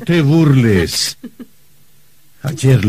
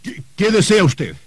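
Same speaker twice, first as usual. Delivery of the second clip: flustered stammer; 7 semitones higher than usual. Acted.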